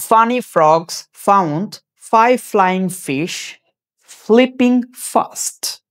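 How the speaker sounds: "Funny frogs found five flying fish flipping fast" crawling, each f leaned on; very slow tongue twister. Shown as a demonstration. Teacher showing the twister to students, very slow. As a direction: drag every word so learners hear the f. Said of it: "সেটা হলো এরকম".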